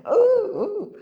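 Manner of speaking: high, loud playful ooh; a knowing, teasing ooh. Real, not acted. Teasing the audience about dating profiles, high playful ooh.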